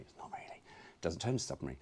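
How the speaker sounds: unvoiced, hushed correction; whispered aside. Shown as a demonstration. Vlogger whispering a correction to viewers. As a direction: whisper it as a secret. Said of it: "whispered aside".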